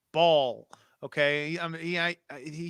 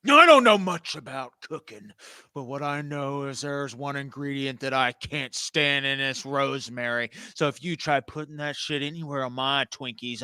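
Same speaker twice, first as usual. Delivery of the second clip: wrestling promo; 7 dB louder than usual. Acted. Wrestling promo against Rosemary.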